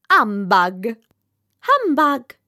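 loud scornful shouts of Humbug; shouting humbug. Acted. Indignant fireworks shouting Humbug at the rocket. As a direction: bark Humbug twice. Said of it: "at the top of their voices".

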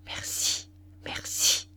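hushed, voiceless whisper; furtive grateful whisper; whispered thanks. A narrator's reading. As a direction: whisper it under your breath in church. Furtive thanks while kneeling in church.

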